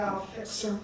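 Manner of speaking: low, very soft vow; quiet threat. Acted. God watching her from behind the tree, quiet threat. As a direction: plot it secretly under your voice.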